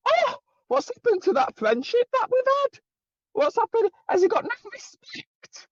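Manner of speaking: high, mocking impression of him; sarcastic high-pitched mockery of a wounded friend; mocking whine. Mocking the other man.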